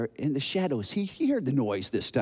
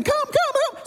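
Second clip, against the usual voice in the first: high-pitched panicked call for help; screaming; 4 dB louder, slower than usual. Acted. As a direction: act a scared child shrieking for his dad. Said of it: "and screaming".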